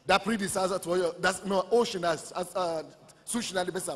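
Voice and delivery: usual speaking voice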